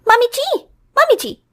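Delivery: high impatient calling out. Acted. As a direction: summon her from across the house. Daughter-in-law calling mother-in-law.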